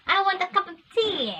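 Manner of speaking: high-pitched exaggerated British accent; imitated British accent. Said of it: "still not British accent".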